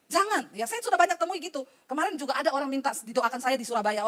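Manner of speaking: ordinary talk, nothing marked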